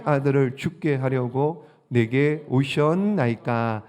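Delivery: slow, solemn Bible reading; scripture reading. Acted. Pastor reads the widow's words from scripture, solemnly. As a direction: read the verse out to the congregation, slowly.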